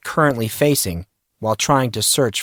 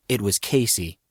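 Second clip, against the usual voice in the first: usual speaking voice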